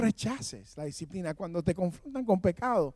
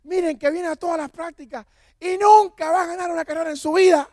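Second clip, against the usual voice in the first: much higher, very loud mocking shout; coach yelling; 10 semitones higher, 10 dB louder than usual. Coach mocking Raudel to the whole team, loud yell. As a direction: roar it out like a coach shaming a runner. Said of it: "él empezó a gritar".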